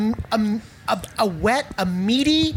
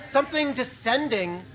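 hushed scene setting; 3 semitones lower, 4 dB quieter than usual. A narrator's reading. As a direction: build awe as it descends. Describes something descending from heaven.

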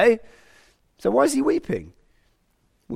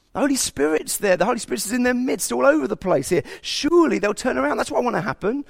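earnest higher-pitched plea, willing them on; hopeful yearning; 6 semitones higher than usual. Acted. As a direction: voice God longing for people to come round. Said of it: "He's thinking".

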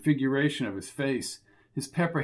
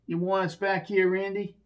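thug voice; 5 semitones higher than usual. Acted. Thug calls from the corridor.

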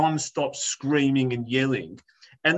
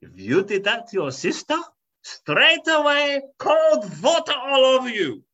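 higher, louder mock threat from a strict mother; a Polish mother scolding her son; stern Polish mum; 6 semitones higher, 3 dB louder than usual. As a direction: warn him like an old-school mum. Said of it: "She's like".

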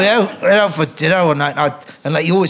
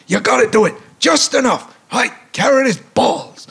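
high coaching voice; Mel Brooks coaching; 9 semitones higher than usual. Retold or imitated. Director coaching the line, high voice. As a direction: show just enough. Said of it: "And he goes".

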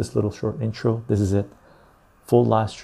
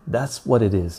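ordinary delivery

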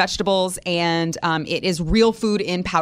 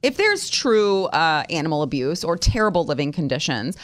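ordinary talk, nothing marked

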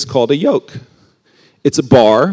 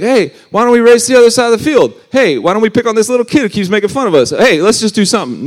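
quick, bright, higher buddy banter; tossing off carefree dares to a pal; playful animal chatter; 8 semitones higher, 4 dB louder than usual. Pretend. Youth preacher voicing two goofing animals, fast and playful.

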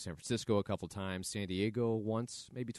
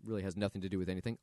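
normal talking voice

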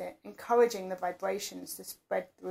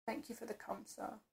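soft, spent mumble after climax; mumbled; 13 dB quieter than usual. Acted. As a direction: mumble it, barely audible and drained. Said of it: "I mumbled".

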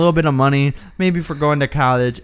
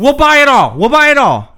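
high, loud, insistent repeat; desperate yelling; 8 semitones higher, 7 dB louder than usual. Acted. Customer cutting off the dithering clerk, desperate yelling. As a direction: cut in at full volume.

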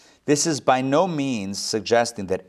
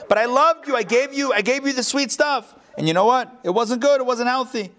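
high, protesting parent voice, a bit injured; pleading parent; 10 semitones higher than usual. Retold or imitated. Parent defending all the sweets to the child, high pleading voice. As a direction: plead like a parent who meant well.

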